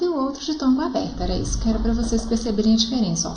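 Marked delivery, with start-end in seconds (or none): none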